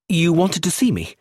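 eager man who's been summoned. A narrator's reading. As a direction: jump in keenly, a bit too eager. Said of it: "said Roger eagerly".

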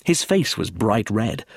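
plain reading voice

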